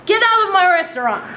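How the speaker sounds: mock-indignant get out; mock angry maitre d'. Maitre d' ejecting the string, mock anger.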